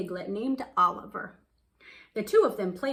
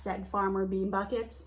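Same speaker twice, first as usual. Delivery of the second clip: ordinary delivery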